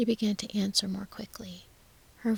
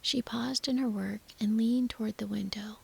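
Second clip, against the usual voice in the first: ordinary delivery, nothing marked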